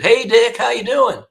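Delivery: high, loud chummy greeting; Pee Wee's greeting. Retold or imitated. Killer greeting the prosecutor in court, high and chummy. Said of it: "He said".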